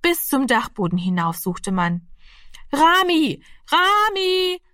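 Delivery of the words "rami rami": high, drawn-out search call. Acted. Household searches house and garden for Rami, high calling. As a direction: call for a missing child, voice pitched far up. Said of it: "tönte es von hier und dort".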